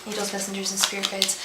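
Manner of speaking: very soft, level prayer chant; hushed ritual recitation under the breath; murmured invocation. Real, not acted. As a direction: murmur it as a repeated incantation. Repeated invocation while shuffling cards.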